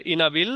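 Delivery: ইনাবিল cut off after bil; learner's misreading. Shown as a demonstration. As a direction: misread inability as ইনাবিল, ending on a rise. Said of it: "সিলেবলের যদি জ্ঞান না থাকে".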